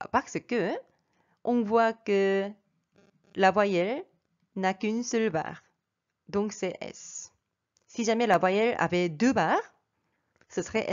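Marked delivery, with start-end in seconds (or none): none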